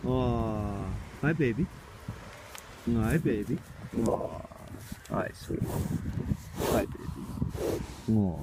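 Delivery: soft, hushed cooing. Real, not acted. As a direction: whisper sweetly to the horse. Cooing to horses.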